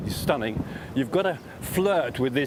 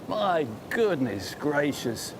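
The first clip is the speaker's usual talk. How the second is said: slow amazement; much slower than usual. Real, not acted. Looking at a tough chip.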